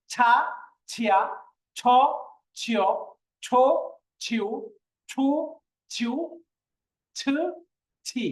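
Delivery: each syllable from ছা to ছি burst out with extra breath; pressed aspiration. Shown as a demonstration. Teacher contrasting with the light letter, ছ strongly aspirated. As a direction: put pressure behind every "chh", spaced one by one. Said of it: "আপনাকে একটু pressure দিতে হবে".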